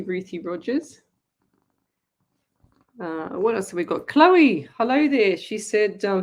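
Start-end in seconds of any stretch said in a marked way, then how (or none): 4.1-5.4 loud, high call of the name; excited greeting. Real, not acted. Host hails a viewer who just commented, excited. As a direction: sing out the greeting with joy.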